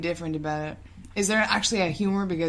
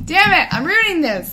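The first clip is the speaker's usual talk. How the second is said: frustrated outburst; 7 semitones higher, 7 dB louder than usual. Real, not acted. Frustrated outburst at own idea.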